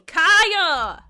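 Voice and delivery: loud call across the room; shouting a friend's name. Real, not acted. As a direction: yell the name out. Calling out to friend.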